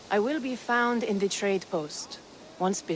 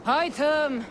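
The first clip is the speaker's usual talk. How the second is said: loud, higher call with a falling end; 7 semitones higher, 5 dB louder than usual. Acted. Visitor searching for Hytham, loud calling out. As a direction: project the name to someone out of sight. Calls the absent man again.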